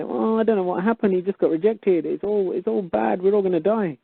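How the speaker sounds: high, whiny worried voice; mock panicking caller. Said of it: "ring up the show and just say".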